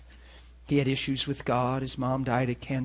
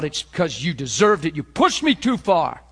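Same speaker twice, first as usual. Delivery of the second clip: angry blowout; 2 semitones higher, 9 dB louder than usual. Acted. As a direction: throw the blame back at them at full volume. Acting out someone's angry blowout.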